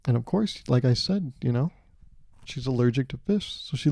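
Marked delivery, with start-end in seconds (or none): none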